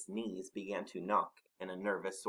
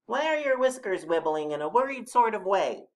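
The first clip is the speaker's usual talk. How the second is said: high, louder small animal; Mole's voice; 11 semitones higher, 8 dB louder than usual. A narrator's reading. Mole asking the Snorklum about his whiskers, high voice. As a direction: pipe up with a pointed question. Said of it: "said Mole".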